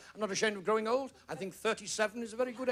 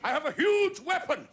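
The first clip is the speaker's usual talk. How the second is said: higher, pompous royal proclamation; boastful king; 9 semitones higher than usual. Retold or imitated. King Arnold of Wigan boasting to everyone, pompous and higher. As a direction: puff up and proclaim like a vain pretender king. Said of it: "He used to go around saying".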